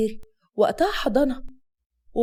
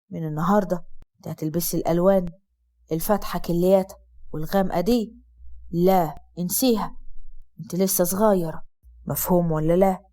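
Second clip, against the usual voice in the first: low, soft and gentle; softly; 7 semitones lower than usual. Acted. Husband gently telling his young wife what to wear, soft and low. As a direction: coax her tenderly, in a low voice. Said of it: "قال لها الكلام بمنتهى النعومة".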